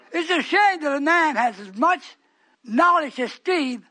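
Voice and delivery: loud, indignant pulpit cry; full-force preaching. Real, not acted. Preacher laments Steve coming against him, loud preaching.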